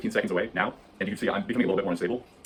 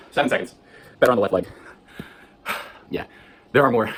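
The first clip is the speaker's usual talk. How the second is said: out of breath; 3 semitones higher, 6 dB louder than usual. Real, not acted. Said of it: "so embarrassing being out of breath from this".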